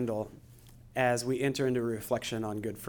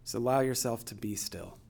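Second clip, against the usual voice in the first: guided meditation; 8 dB quieter than usual. Acted. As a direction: gently guide them into stillness. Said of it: "I'm gonna take us through a guided meditation".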